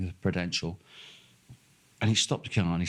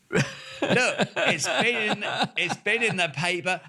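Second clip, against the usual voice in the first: mocking indignant kid; 11 semitones higher, slower than usual. Mocks the young driver's letter.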